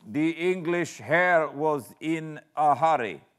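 high, loud, nervous coachman voice; stammering coachman. A narrator's reading. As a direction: blurt it out like a frightened driver. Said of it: "The man stammered in reply".

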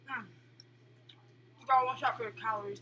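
talking while chewing. Real, not acted. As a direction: mouth partly full. Seen 0: Puts food in mouth at -1; jaw working through the labelled words.

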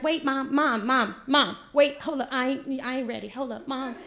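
put-on a seven-year-old begging mom to wait; panicked child. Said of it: "I'm like".

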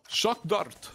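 bark-free, hammed-up attempt at a Russian accent. Co-caster imitates Russian game character Sova, mock Russian accent. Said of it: "If that was your Russian accent".

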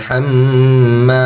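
هاممা said with a light, thin ha and a held doubled mim, slowly. Shown as a demonstration. Quran teacher showing students the light ha, slow demo. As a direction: keep the ha thin in হাম্মা. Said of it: "হা পাতলা হবে".

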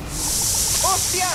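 high startled curse; raging gamer. Real, not acted. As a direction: blurt it out in shock. Sudden attack in game.

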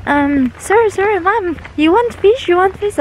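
high-pitched imitation of eager kids; children calling out. Children offering fish to picnickers, imitated high calling. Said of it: "They said".

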